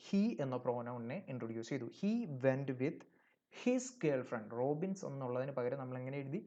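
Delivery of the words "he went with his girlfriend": very slow, word by word; slow example reading. Shown as a demonstration. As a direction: read the corrected sentence slowly. Teacher reads the corrected sentence. (പതുക്കെ വ്യക്തമായി വായിക്കുന്നു)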